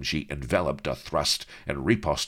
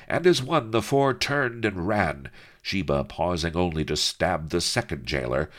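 ordinary delivery

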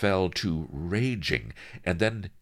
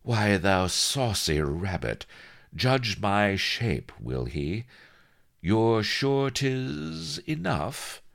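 slow, higher, coquettish delight; purring; 7 semitones higher, slower than usual. A narrator's reading. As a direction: bask flirtatiously in the flattery. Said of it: "she fairly purred".

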